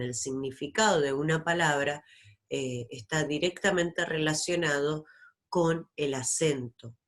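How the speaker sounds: normal talking voice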